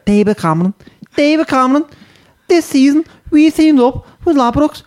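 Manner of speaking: very high, squeaky mock Scouse; Scouse accent warm-up. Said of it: "get into it by doing David Cam".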